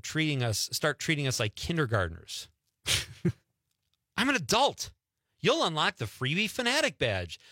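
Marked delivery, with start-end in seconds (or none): 4.2-4.9 high-pitched comic indignation; indignant. Real, not acted. Customer objecting to kindergarten-style rewards, indignant. 5.4-7.4 higher-pitched scornful repeat; indignant mocking quote. Host mocking a sandwich chain's reward offer, indignant.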